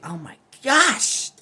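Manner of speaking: high, loud, drawn-out slow yelp; real startled exclamation. Real, not acted. Catching a knot in her hair mid-video, startled high yelp. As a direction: cry out at a sudden tug. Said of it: "Sorry, there was a knot in my hair".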